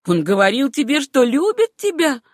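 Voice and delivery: incredulous, rising demand; piercing, hateful question. A narrator's reading. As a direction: pitch climbing high in disbelief. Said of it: "голос её стал пронзительным".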